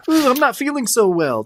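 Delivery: high, whiny queasy voice; a hammed-up high moan of feeling unwell; mock queasy. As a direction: play at feeling ill. Voicing the guy.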